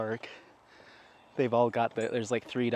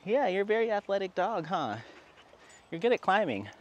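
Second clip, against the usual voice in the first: higher, delighted praise for a pet; dog praise voice; 7 semitones higher than usual. Real, not acted. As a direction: cheer the dog on like a proud owner.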